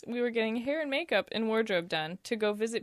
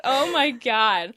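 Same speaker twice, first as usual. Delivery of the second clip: laughing; 2 semitones higher, 8 dB louder, slower than usual. Real, not acted. Own dream embarrasses her.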